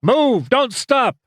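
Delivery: high-pitched yell above the racket; urgent officer's shout. A narrator's reading. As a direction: yell the order over gunfire. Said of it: "Winters yelled above the racket".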